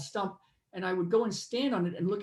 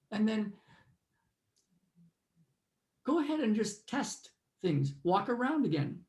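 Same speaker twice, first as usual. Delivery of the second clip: guided meditation. Acted. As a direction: lead them inward, unhurried.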